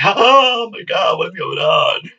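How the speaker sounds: drawn-out mock panic cry; imitating friend freaking out. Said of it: "she's freaking out with cops and everything".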